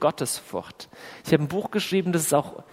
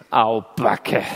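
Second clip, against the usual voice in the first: much lower, drawn-out groan of "Au, Backe"; mock dismay; 8 semitones lower, 3 dB louder than usual. Real, not acted. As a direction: wince at how costly it is. Preacher reacts to the dangerous prayer.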